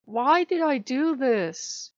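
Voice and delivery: slow, high despairing whine; slow wail of regret. Retold or imitated. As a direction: drag it out in dismay. Despairing at her own mistake.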